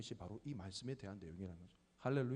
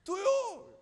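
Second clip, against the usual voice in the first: put-on toddler demanding; 10 dB louder, much slower than usual. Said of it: "이 세 마디로".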